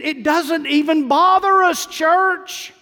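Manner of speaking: high, loud pulpit exhortation; preaching loud. Real, not acted. Preacher exhorting congregation loudly.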